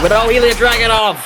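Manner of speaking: high, sudden cry of surprise; shocked shout. Real, not acted. Commentator seeing Ilya Dragunov walk out, shocked. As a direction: burst upward on the name.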